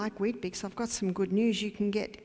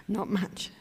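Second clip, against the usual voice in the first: laughing softly through the words; soft laugh on the repeat; 3 dB quieter than usual. Real, not acted. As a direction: chuckle out not much.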